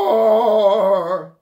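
huge, high, long roar; dinosaur roar. Shown as a demonstration. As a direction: roar at full volume like a dinosaur. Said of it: "roar like a dinosaur".

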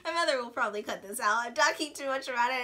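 ordinary talk

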